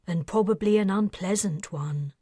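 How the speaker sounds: ordinary delivery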